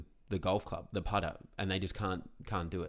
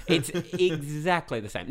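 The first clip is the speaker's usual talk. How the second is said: louder, slower, laughing insistence; 8 semitones higher, 8 dB louder, slower than usual. Shown as a demonstration. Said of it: "repeated louder, laughing".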